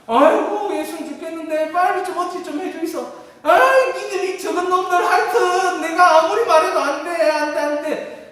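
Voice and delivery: high, loud, laughing comic plea in dialect; hammed-up dialect pleading. Disciple begging Jesus for help, loud comic dialect. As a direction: play the desperate disciple and the scolding Jesus for laughs.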